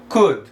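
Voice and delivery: "could" with only a u sound in the middle; "could" as a model word, short u; correct pronunciation. Shown as a demonstration. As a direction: say "could" like "kud", L silent. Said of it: "como se tivesse só um U ali no meio".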